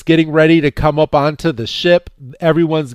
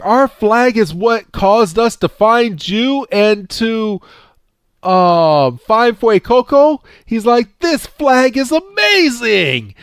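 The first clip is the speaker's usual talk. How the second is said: much higher, excited gushing voice; 9 semitones higher than usual. Retold or imitated. Freed praising the flag to Roy, high and gushing. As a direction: gush like a giddy kid.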